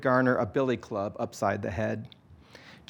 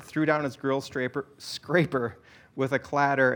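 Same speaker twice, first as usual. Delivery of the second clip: plain reading voice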